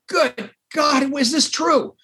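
a desperate man blurting hope at the stranger on the phone; frantic excitement. Retold or imitated. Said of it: "I'm crazy".

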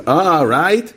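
exaggerated Hollywood-style storytelling; movie-world version. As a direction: ham up a storybook ending. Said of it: "in the movie world".

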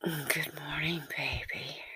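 soft, intimate whisper; hushed morning endearment. A narrator's reading. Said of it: "And you whisper".

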